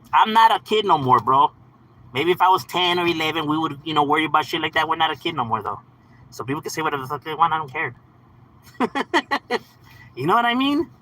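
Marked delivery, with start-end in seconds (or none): none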